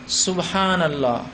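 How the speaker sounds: slow, soft subhanallah as a gentle prompt; a quiet, drawn-out subhanallah; soft reminder. Shown as a demonstration. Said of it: "مرد لقمہ دیں گے".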